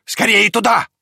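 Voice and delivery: high, loud urgent cry; dog's shout. A narrator's reading. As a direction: urge them on excitedly. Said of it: "закричала собака". (взволнованный крик собаки)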